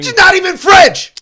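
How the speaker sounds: high, loud snap; angry shout. Real, not acted. Yelling at the dog lady in his head, angrily. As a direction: yell it at her.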